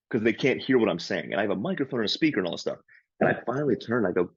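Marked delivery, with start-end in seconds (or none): none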